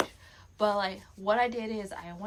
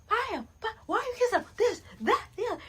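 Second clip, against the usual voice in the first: high, louder mock shouting at the screen; mock yelling viewer; 13 semitones higher, 6 dB louder, faster than usual. A fan yelling at the Love Island screen, high and loud. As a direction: play a rowdy fan yelling at the TV.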